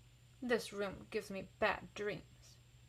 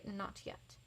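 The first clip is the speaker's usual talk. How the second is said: whisper; 12 dB quieter than usual. A narrator's reading. Child feeling unwell.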